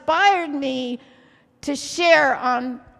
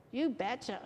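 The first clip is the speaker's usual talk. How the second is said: put-on soft, playful Minnesota accent; Minnesotan accent; 3 semitones lower, 12 dB quieter than usual. Preacher joking with the congregation, soft Minnesotan accent. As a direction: toss it off in Minnesotan. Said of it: "Let's all go Minnesotan today".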